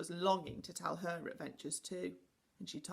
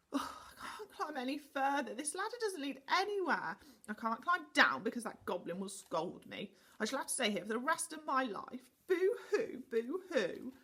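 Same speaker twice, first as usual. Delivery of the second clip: higher, whiny mock sobbing; 6 semitones higher, 4 dB louder than usual. A narrator's reading. Stuck Connie wails up the ladder, mock sobbing. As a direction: wail like a stuck, sulky child. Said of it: "Connie sobbed".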